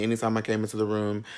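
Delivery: normal talking voice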